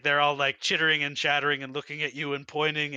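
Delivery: ordinary talk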